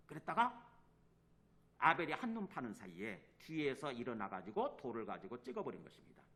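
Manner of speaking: ordinary delivery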